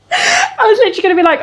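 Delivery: words bubbling out on a laugh; real laughter. Real, not acted. Laughing through tears to her viewers.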